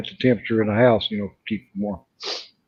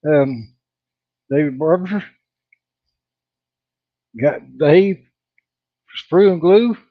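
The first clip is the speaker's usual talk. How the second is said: normal talking voice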